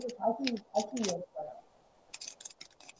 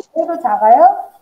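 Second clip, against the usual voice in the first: much louder, raised voice; to someone hard of hearing; 4 semitones higher, 13 dB louder than usual. Real, not acted. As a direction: speak up over a bad connection. Still too quiet.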